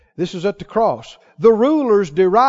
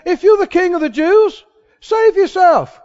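mocking taunt; 7 semitones higher, 4 dB louder than usual. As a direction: sneer it up at the cross. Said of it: "They mocked him".

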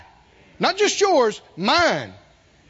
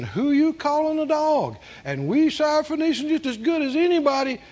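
mock-indignant, higher-pitched comeback; indignant retort; 7 semitones higher than usual. Offended woman getting in his face, indignant retort. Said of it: "Instead of jumping up and getting in his face".

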